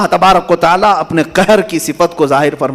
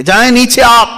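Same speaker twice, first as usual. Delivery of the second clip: raised, emphatic retold order; command; 6 semitones higher, 4 dB louder than usual. God orders the angel Gabriel down, emphatic command. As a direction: deliver it as a ringing command from above. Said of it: "حکم دیا".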